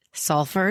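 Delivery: "Salford" as SOL-furd, R sounded; questioning "Salford", rhotic ending; American R. Shown as a demonstration. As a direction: ask with "Salford" keeping the American R. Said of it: "Do I say the R?".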